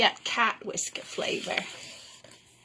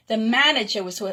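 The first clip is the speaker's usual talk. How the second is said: usual speaking voice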